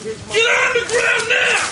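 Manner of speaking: commanding shout at full force. Real, not acted. Officer orders suspect down.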